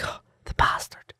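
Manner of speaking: whispered curse; soft, voiceless aside; hushed mock resentment. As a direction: whisper it under your breath. Spotting the villain at the gala.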